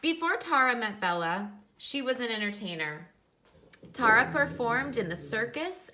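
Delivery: picture-book reading voice; reading to children. Acted. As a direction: read it out brightly for the class. Reads picture book aloud to class.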